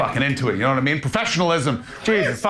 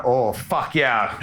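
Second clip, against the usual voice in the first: slow, lower drawn-out delight; mock delight; 5 semitones lower, much slower than usual. Real, not acted. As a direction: savor it like a prize. Delighted at drink find.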